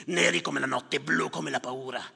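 ordinary talk

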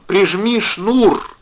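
high, drawn-out yell; loud, emphatic command; shouting. A narrator's reading. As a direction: bellow each word slowly to be heard. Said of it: "кричал он".

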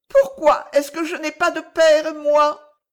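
put-on high, loud child demanding why; protesting little boy. A narrator's reading. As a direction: plead loudly like a small boy. Child protests having no father. (voix aiguë d'enfant qui proteste)

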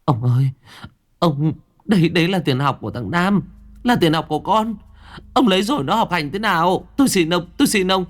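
wife begging her husband, on the verge of tears; tearful pleading. A narrator's reading. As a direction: beg him through tears. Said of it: "bà Xuân vừa mếu máo".

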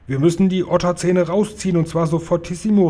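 pressing order to act at once; urgent call. A narrator's reading. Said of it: "rief er".

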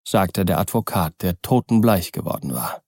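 ordinary delivery

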